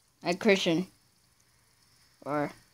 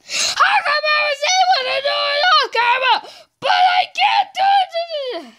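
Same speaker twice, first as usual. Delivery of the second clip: whiny high shout; faster than usual. Real, not acted. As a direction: complain at the top of your voice. Failing jump on camera.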